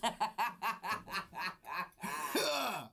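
long hammed-up wrestler cackle; a drawn-out mock villain laugh. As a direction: laugh like a cocky heel. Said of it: "That's my wrestler laugh".

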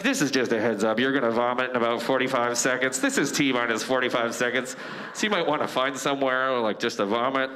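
low, measured warning voice; deadpan low-voiced body giving a heads-up; body's calm announcement. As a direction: announce it like a flight attendant.

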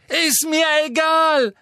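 high, loud screaming retort. A narrator's reading. Harry screams at Amanda Dippet's portrait. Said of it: "schrie Harry sie an".

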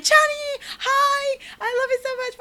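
high, squealing declaration of love; hyped fangirl. Retold or imitated. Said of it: "so me being hype".

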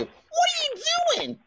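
very high cartoon voice; Bugs Bunny. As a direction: squeal it like a cartoon wiseguy. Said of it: "You sound like Bugs Bunny".

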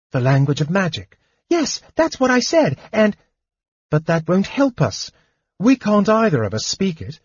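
a small kender bursting with news; chattering high kender, excited. Acted. Tas the kender's voice.

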